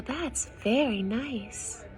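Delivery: plain reading voice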